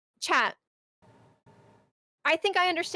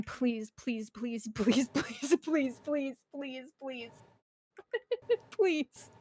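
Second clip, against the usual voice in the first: softer, imitated begging, half-laughing; mock pleading; 4 dB quieter, slower than usual. Worker begging the ice cream machine, mock tearful pleading. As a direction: beg the machine, a touch tearful. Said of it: "standing in front of the ice cream machine crying".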